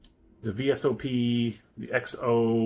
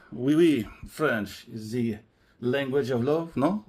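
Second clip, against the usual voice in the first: slow, hammy mock French lover; "French is the language of love" in a corny French accent; hammed-up French accent; slower than usual. Wine host clowning for viewers, a hammed-up French accent. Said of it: "You have to do it stupidly".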